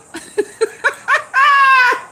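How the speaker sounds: high, shrieking cackle of real laughter; tipsy howl of laughter; big laugh. Real, not acted. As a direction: let a shrill laugh burst out. Tipsy host cracking up at chat.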